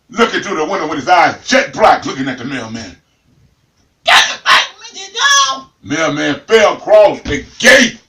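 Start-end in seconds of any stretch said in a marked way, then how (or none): 4.0-4.7 parrot rasp. Acted. Parrot at the window. 4.8-5.7 parrot squawk. Acted.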